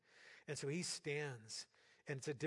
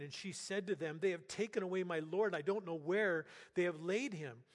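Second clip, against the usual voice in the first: raised, louder preaching voice; preaching scripture; 3 semitones higher, 5 dB louder than usual. Acted. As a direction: proclaim the text to the whole church. Reading scripture at full force.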